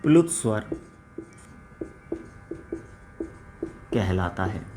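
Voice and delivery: slow, low-key dictation with long pauses. Acted. Teacher dictating the pluta vowel definition, measured dictation. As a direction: dictate it phrase by phrase, leaving writing time.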